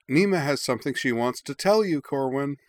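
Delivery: chirpy and loud, as if from the hall; cheerful. A narrator's reading. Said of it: "said Lakva cheerfully from the hall".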